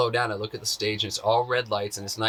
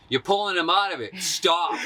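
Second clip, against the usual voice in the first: raised, higher-pitched telling-off; mock scolding; 8 semitones higher, 4 dB louder than usual. Festival friend to the person nudging the dancer, raised mock scolding. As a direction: imitate a firm protective interjection, pitched up. Acts brother scolding the nudger.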